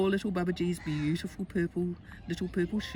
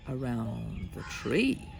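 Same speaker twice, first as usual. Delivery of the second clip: ordinary delivery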